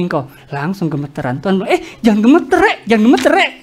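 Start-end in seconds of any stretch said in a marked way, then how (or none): 1.7-3.5 put-on light Surabaya accent, the final "Rek" casual; a hint of Surabaya street talk; playful mock Surabaya accent; Surabaya Javanese manner. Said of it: "Tuhan kayak gitu bahasa Surabayanya".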